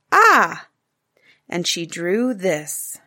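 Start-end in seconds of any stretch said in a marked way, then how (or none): 0.1-0.7 higher, louder Ah!; little girl's startling Ah; child's Ah noise. Acted. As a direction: shout the surprise noise like a child. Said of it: "and that means".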